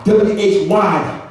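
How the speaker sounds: slow, letter by letter W H Y; spelled out. Shown as a demonstration. Pastor spells out the key word slowly. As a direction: space each letter. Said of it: "I call it why".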